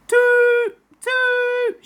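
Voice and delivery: high, loud hooting toot; wailing car horn. A narrator's reading. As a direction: wail like an old car horn. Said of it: "she wailed".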